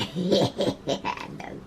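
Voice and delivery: quiet airy laugh; breathless laugh. Real, not acted. To viewers after the imagined retort, soft laugh. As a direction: laugh under your breath.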